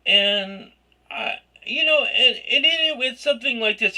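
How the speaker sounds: normal talking voice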